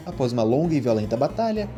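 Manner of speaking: ordinary delivery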